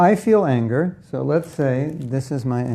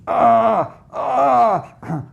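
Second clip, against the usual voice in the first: high, loud strained "ah" cries; two high anguished yelps of rage; angry cries; 8 semitones higher, 3 dB louder than usual. Acted. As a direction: cry out like someone boiling over with anger. Acting out an angry person.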